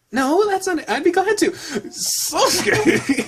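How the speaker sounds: high, giddy squeal of delight; excited high-pitched acceptance; eager character voice. Acted.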